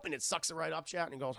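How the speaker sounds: normal talking voice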